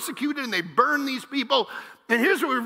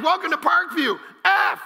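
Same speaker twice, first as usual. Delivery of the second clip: mock preacher with tic; 7 semitones higher, 3 dB louder than usual. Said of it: "randomly throw F-bombs around is a preacher".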